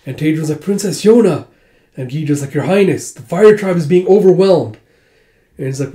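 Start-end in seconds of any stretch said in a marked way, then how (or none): 0.6-1.5 raised, higher exclaimed name; calling out. Retold or imitated. Lord to the princess in battle, calling out. 2.5-4.8 urgent report. Retold or imitated. Retainer to Her Highness, urgent battle report.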